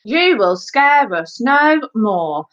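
slow, high declamation; laboured amateur stage speech; stilted school-play acting. A narrator's reading. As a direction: recite word by word, then get stuck. Elvis declaims, forgets his line.

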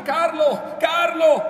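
very high, full-force cry of a name; piercingly high, urgent call; screaming for help. A narrator's reading. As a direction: scream out to someone beyond the door.

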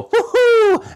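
hoge, luide Mario-kreet woohoo. Shown as a demonstration. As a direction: roep woohoo als Mario, hoog en uitgelaten. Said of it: "you might recognize me better as Mario".

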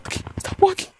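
urgent whisper; tense, voiceless hush; hushed warning. Real, not acted. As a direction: whisper it so the pursuer can't hear. Hiding from the pursuer.

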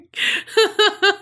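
high laugh. Real, not acted. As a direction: crack up. Cohost laughing.

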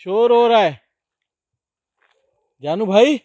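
high, very loud shouts across distance; alarmed calling out. Real, not acted. Explorer alone reporting noise to Janu bhai, alarmed shouting. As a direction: shout for your companion, alarmed.

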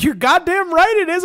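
retelling a bar standoff, shouting back in triumph; defiant yell. Retold or imitated. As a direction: yell it back like you won the room. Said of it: "And I went".

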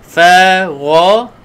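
ra said from the throat, not fa; demonstrating the throat letter ra; throaty gh sound. Shown as a demonstration. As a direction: push ra back to the throat. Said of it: "huruf ra dekat halkum".

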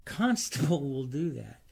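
a real chuckle breaking into the name; laughing at his own slip. Real, not acted. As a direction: laugh through the line after fumbling the name. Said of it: "Keep wanting to call him Constance".

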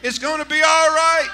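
loud, high, shouted promise; preacher's shouted declaration. Shown as a demonstration. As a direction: belt out the promise to the church. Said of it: "Keep saying it".

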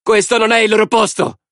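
very high-pitched woman's cry; high, hostile outcry; shouted protest. A narrator's reading. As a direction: shout them away from the crowd. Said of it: "gridò un'altra donna".

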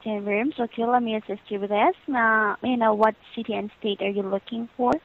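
ordinary delivery

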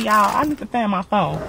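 usual speaking voice

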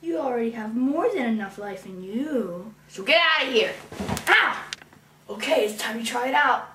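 usual speaking voice

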